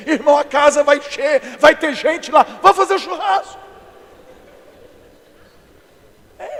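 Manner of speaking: loud, high comic sermon rant; full-force preaching. Acted. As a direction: shout it out to the whole congregation. Preaches comic point at full volume.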